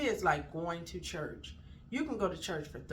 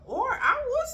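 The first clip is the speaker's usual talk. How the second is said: sudden high exclamation; 10 dB louder than usual. Real, not acted. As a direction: lift it up high and loud.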